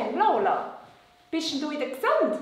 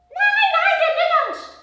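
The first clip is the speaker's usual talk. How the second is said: high, louder puppet urging; excited puppet voice; 9 dB louder than usual. Acted. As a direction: hurry him in a high puppet voice. Puppet Lola insists.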